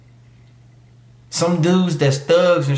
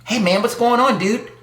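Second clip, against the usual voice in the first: mocking a corny dude; 7 semitones higher than usual. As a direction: ham up an uncool guy saying hi. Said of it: "You don't want those t-, kind of guys".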